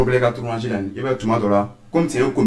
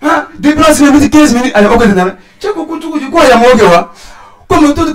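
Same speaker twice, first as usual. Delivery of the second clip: mock argument shouting; 8 semitones higher, 6 dB louder than usual. Acting the waiter argument.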